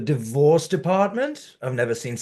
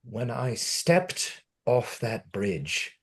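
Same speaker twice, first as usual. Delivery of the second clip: ordinary talk, nothing marked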